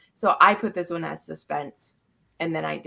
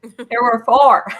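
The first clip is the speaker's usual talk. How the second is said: loud, slow there were four; emphatic correction; 5 semitones higher, 11 dB louder, slower than usual. Real, not acted.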